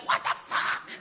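breathy exasperated whisper under the breath; whispered what the fuck. Retold or imitated. Alone in the morning without hot water, an exasperated whisper. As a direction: mutter it to yourself in disbelief.

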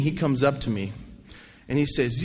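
usual speaking voice

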